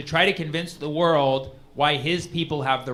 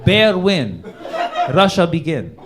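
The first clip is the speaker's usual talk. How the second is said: mock Putin screenplay; 4 dB louder than usual. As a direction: narrate like a solemn movie trailer in broken English. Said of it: "He's like".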